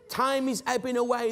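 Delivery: ordinary talk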